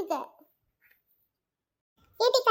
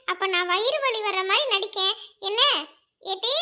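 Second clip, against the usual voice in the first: usual speaking voice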